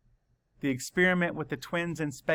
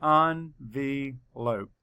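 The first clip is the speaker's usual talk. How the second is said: slow, each syllable counted out; much slower than usual. Shown as a demonstration. Teacher counting syllables slowly for the class. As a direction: tap out every syllable slowly. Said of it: "Count the syllables".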